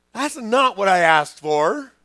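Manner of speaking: loud, slow indignant complaint; hammed-up outraged whine; mock protest. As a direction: drag it out like a let-down kid. [name] protesting God's answer.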